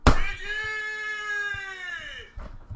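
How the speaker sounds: loud exaggerated sigh. Real, not acted.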